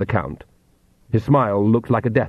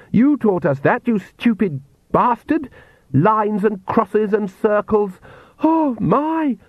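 high-pitched hysterical tirade; screamed rant; 9 semitones higher than usual. A narrator's reading. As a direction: rant at father, pitch climbing toward the end. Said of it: "Lacrimosa screamed".

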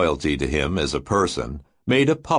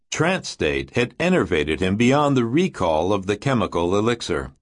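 usual speaking voice

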